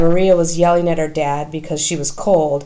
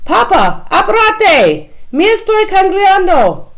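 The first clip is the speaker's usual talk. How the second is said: much higher, loud, urgent yelling at her dad; impatient yell; 14 semitones higher, 5 dB louder than usual. A narrator's reading. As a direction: hurry him up, yelling. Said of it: "then yells".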